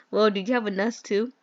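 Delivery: ordinary talk, nothing marked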